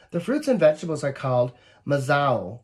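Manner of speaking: ordinary delivery, nothing marked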